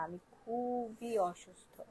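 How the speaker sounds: slow, soft, sickly; too ill to speak up; weak, sick voice. Real, not acted. Seller tells viewers she is ill, weak and slow. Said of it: "আমি খুবই আস্তে আস্তে কথা বলতেছি".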